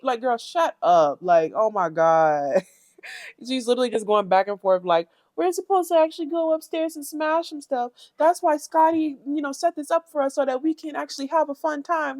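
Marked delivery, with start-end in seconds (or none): 5.4-12.2 somewhat raised prissy imitation; a hint of her whiny tone; lightly voiced mock Natalie. Mocking Natalie again.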